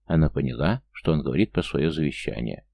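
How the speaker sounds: ordinary delivery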